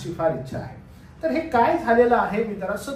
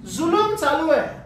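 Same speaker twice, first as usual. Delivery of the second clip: emphatic repeat; 9 semitones higher, 8 dB louder than usual. Shown as a demonstration. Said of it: "काय चालू आहे?".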